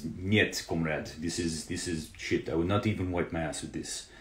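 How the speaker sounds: badly faked Russian accent, mock Putin; a cheesy put-on Russian accent; terrible Russian accent. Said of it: "That's terrible Russian accent".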